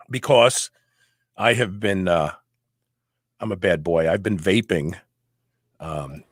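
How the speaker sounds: wheezing, low and breathy; wheezy voice. Real, not acted. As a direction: voice catching from vaping. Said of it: "I'm wheezing".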